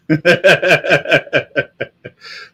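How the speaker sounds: loud, high rapid cackle; long rattling laugh. Real, not acted. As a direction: crack up at your own bit. Laughing at own impression.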